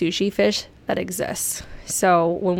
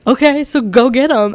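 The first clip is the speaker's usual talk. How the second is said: louder and higher mock call from AJ; urging shout; 5 semitones higher, 9 dB louder than usual. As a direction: cheer her on like an excited husband. Voicing AJ urging her on.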